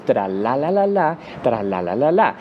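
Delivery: tra la la la la run together smoothly; connected English-style sing-song; linked flowing rhythm. Shown as a demonstration. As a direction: glide the syllables into one stream. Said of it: "agrupando sonidos".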